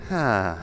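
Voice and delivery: long weary sigh; deep, resigned exhale. Acted. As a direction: let out a heavy acted sigh. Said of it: "makes a very deep sigh".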